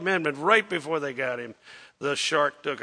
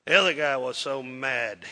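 ordinary talk, nothing marked

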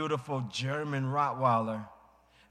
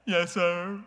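put-on a touch of a small boy, higher; child's meek reply; 5 semitones higher, 4 dB louder than usual. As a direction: answer like an obedient little boy. Son answers father.

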